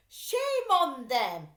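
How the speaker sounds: slow, loud indignant mother; Mrs Lambchop, firm. A narrator's reading. Mother scolding the world, slow and loud. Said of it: "Missus Lambchop said".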